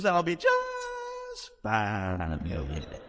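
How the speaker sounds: very slow, drawn-out Georgia drawl; Southern lady voice. Retold or imitated. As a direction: savour every word like a pleased church lady. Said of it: "She goes".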